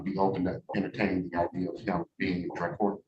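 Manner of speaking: said too quietly to hear; quiet mumble. Real, not acted. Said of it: "Speak up, I can't hear you".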